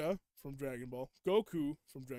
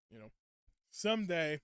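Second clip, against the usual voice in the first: usual speaking voice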